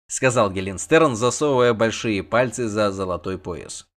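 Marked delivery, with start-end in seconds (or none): none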